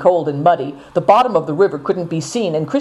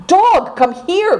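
calling out; 13 semitones higher, slower than usual. A narrator's reading. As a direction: call the dog from afar. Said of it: "cried Seven Stars once more".

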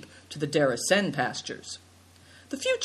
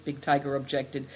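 ordinary delivery, nothing marked

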